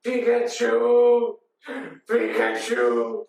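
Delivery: long, drawn-out creature cries; grieving Pikachu. Retold or imitated. Pikachu beside dead Ash, slow drawn-out wail. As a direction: stretch each cry out mournfully. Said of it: "because Pikachu's sitting there".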